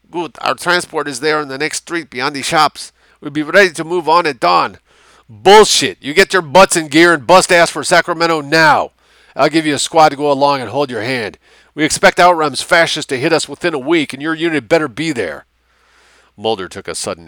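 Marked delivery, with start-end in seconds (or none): 0.1-4.8 put-on high, firm Mexican-accented voice; Mexican officer. A narrator's reading. Mexican officer tells liaison of dawn departure, accented. 5.3-15.4 high, loud, bullying orders; domineering civilian liaison. A narrator's reading. Civilian orders Mexican officer to Sacramento, loud and bullying.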